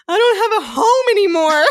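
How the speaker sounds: high, loud wail breaking into a laugh; half-laughing replay of a teenage sob; mock bawling. Nineteen-year-old crying to her mom on the stairs, laughing wail. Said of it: "I was sitting on the stairs just bawling".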